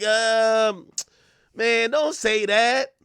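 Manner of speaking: hesitant, high, drawn-out mild objection; mad respectful. Retold or imitated. Rappers reacting to [name]'s out-of-pocket remark, mad respectful. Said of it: "they be mad respectful".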